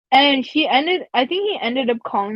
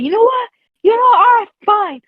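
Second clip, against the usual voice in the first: higher, louder mock exasperation; exasperated teacher; 9 semitones higher, 5 dB louder than usual. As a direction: imitate the teacher throwing up his hands, a bit louder.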